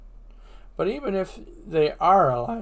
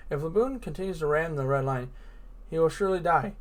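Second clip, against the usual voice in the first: ordinary talk, nothing marked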